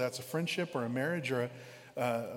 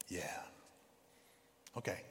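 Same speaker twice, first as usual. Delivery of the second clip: gentle Jesus; 6 semitones lower, 8 dB quieter than usual. Retold or imitated. Jesus answers the beggar gently.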